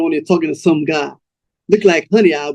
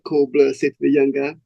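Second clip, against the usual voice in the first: usual speaking voice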